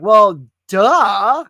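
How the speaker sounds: a loud, high, incredulous well duh; exasperated retort. Retold or imitated. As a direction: throw the obvious back at him, loud and high. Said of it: "I'm like".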